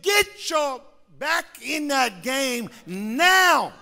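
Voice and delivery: slow, stern shouted order; stern dad order. Retold or imitated. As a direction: lay down the law like a hard father. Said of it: "He said".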